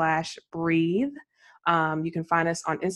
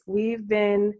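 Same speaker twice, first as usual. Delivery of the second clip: usual speaking voice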